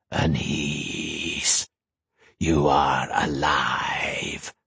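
low, long hissing voice in the dark; drawn-out hiss. Acted. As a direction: stretch the name into a low hiss. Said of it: "The voice was a hiss in the dark".

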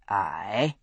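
deep, guarded Scots aye; a wary low Highland aye. A narrator's reading. Jamie wary.

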